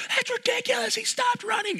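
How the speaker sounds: very high, shrill indignant shout; furious shout. Retold or imitated. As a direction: scream your outrage at the call. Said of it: "He was furious".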